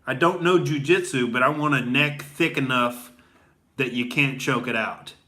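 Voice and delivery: ordinary delivery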